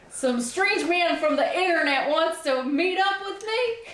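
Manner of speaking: high, hammed-up Southern accent; fake Southern accent. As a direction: play the wary Southern belle. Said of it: "fake Southern accent comes out sometimes".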